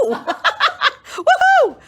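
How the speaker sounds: high, laughing cheer; laughing woohoo. Real, not acted. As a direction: whoop it with a laugh. Cheering own result.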